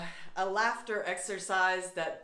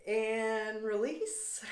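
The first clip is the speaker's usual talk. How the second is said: slow release cue; much slower than usual. Shown as a demonstration.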